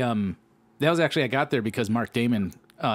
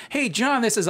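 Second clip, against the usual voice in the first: bright introduction; 12 semitones higher than usual. Retold or imitated. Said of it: "when she starts being like".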